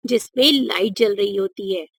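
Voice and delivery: ordinary delivery, nothing marked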